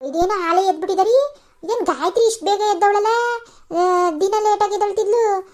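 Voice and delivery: a hint of a curious elder woman; lightly voiced other woman. Another family member wakes to noise.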